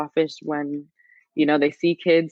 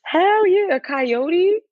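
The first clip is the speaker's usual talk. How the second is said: scared yelp; 9 semitones higher than usual. Real, not acted. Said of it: "It's scary?".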